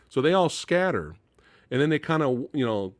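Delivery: normal talking voice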